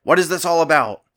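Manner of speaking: king's booming, high-pitched yell from the throne. A narrator's reading. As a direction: bellow it down the empty hall. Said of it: "the king sitting in his throne yelled out".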